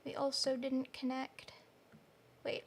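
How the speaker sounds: ordinary delivery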